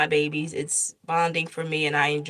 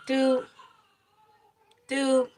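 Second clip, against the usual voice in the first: slowing heartbeat beep; 7 semitones higher than usual. Retold or imitated. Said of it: "it went from regular to, like".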